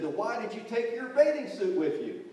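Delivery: high, loud challenging question; acted indignant mom; scolding mother. A narrator's reading. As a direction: catch the boy out. Mother challenging son in story.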